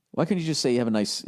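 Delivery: ordinary talk, nothing marked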